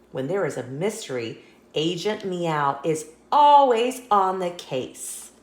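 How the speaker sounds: plain reading voice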